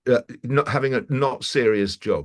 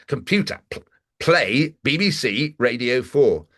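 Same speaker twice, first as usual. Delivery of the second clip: slow, laboured order to a machine; acted frustration at a voice assistant; exasperated command; 3 semitones higher, slower than usual. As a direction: spell out the order with strained patience. Third, exasperated try at the command.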